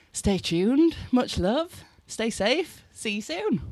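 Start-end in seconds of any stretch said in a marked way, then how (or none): none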